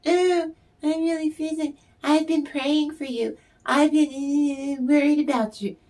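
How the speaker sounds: high, syrupy mock-caring voice; fake concern. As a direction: mimic a fake friend oozing false sympathy. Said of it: "They don't really care".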